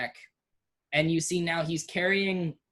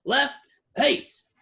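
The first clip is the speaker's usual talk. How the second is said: a higher, sharp parade order; drill command; 10 semitones higher, 3 dB louder than usual. Acted. Commander orders his soldiers, in a barked drill call. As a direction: bark it like a drill sergeant. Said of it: "and he says".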